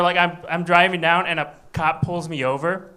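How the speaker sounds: ordinary delivery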